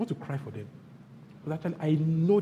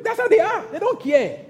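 a high, loud burst of exasperation; exclaimed; 8 dB louder than usual. Real, not acted. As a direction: throw it out in disbelief. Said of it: "exclaimed point".

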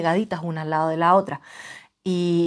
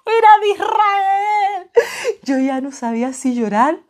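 mock wail; 10 semitones higher, slower than usual. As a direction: wail it like comic despair. Mock lament about the stranger. (lamento fingido, lento y agudo)

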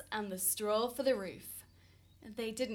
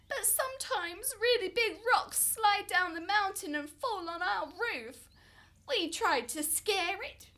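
high, plaintive pleading voice; pleading couple voice; 8 semitones higher, 4 dB louder than usual. A narrator's reading. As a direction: beg the wise man for help. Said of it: "They said".

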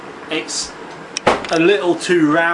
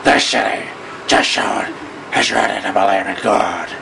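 husky, lowered Batman rasp; 6 semitones lower than usual. Retold or imitated. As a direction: growl it like a wolf. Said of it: "Batman's a little bit more husky".

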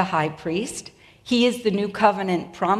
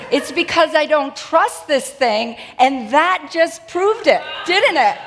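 amused exclamation; 6 semitones higher, 5 dB louder than usual. Real, not acted. Joking about distrusted computer, laugh heard.

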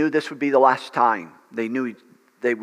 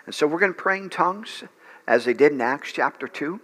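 ordinary talk, nothing marked

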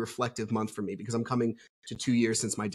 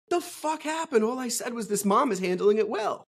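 high-pitched, incredulous complaint; retold indignant disbelief; bewildered protest; 9 semitones higher than usual. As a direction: protest your innocence, baffled and high. Acted bewildered protest after retold quarrel.

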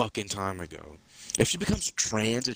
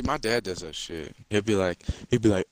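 normal talking voice

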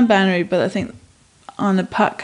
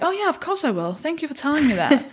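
bright, higher eager agreement; mock cheerful; 7 semitones higher than usual. Replying to a blunt order, mock cheerful. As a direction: chirp it back happily.

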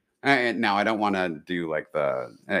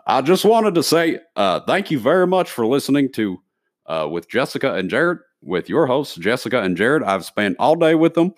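put-on Southern accent; 4 semitones higher than usual. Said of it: "I go Southern when I".

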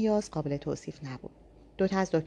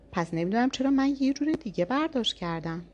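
higher, provoking lilt; needling tone; 6 semitones higher than usual. A narrator's reading. Narrator goading Shahin at lunch, higher needling tone. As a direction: say it to get under his skin. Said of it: "با لحن حرص دربیاری گفتم".

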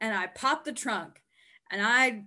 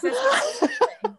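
laughing; 12 semitones higher, 7 dB louder than usual. Real, not acted. Laughing agreement.

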